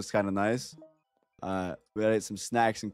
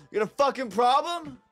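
angry challenge; 13 semitones higher than usual. Real, not acted. Game character confronts him.

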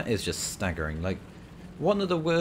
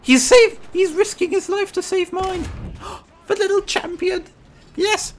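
high-pitched, loud cheering squeal; thrilled shouting; 8 dB louder than usual. Real, not acted. Player hailing the teammate who risked his life, thrilled shouting.

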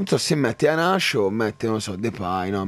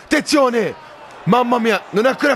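excited shout; 10 semitones higher than usual. Real, not acted. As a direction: shout it out as the ball flies close. Near chance in the match. (grido agitato)

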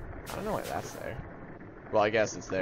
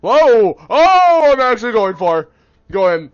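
high, very loud outburst; startled shout; 9 semitones higher, 16 dB louder, slower than usual. Real, not acted. Player shocked at own attack, startled shout. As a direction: burst out in shocked disbelief.

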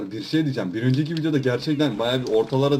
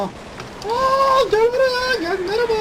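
very high, cartoonish calling voice; squeaky taunt; much slower than usual. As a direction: lure them in with a silly squeaky voice. Taunting players as a zombie. (çok ince, alaycı çağıran ses)

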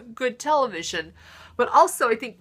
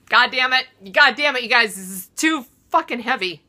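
louder, higher voice cracking with tears; crying outburst; 5 semitones higher, 5 dB louder than usual. Real, not acted. On her comedy show, overwhelmed and crying mid-sentence. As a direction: fight back tears as it bursts out. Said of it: "and I'm crying".

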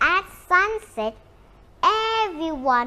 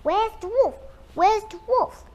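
urgent searching; 7 semitones higher than usual. Acted. Villagers search again.

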